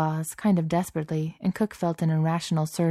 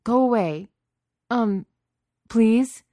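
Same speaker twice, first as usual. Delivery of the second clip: higher, louder, awkward plea; timid pleading; 7 semitones higher, 6 dB louder, slower than usual. A narrator's reading. Beast unable to scare Cook away, awkward pleading. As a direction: order him off, then falter into begging.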